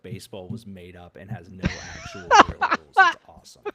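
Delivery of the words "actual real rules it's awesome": much higher, louder mocking squeal. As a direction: squeal it in a silly high voice. Mocking baseball, high loud voice.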